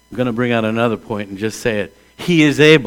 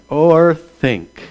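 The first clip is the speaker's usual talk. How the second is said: ordinary talk, nothing marked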